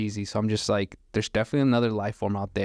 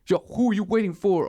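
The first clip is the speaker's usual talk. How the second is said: retold challenge, pitched up; mock confrontation; 8 semitones higher, 3 dB louder than usual. Gunman challenges the waiting driver, mock confrontational. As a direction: demand an answer, acting out the stranger.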